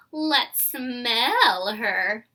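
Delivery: very slow, loud, drawn-out taunt; mocking. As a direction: stretch every word to tease her. Girls taunt Chrysanthemum.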